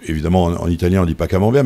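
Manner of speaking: ordinary talk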